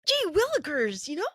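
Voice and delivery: Tom Holland impression. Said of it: "like my daughter says, Tom Holland with the Spider-Man".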